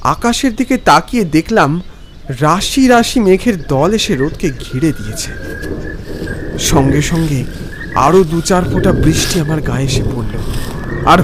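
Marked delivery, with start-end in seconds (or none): none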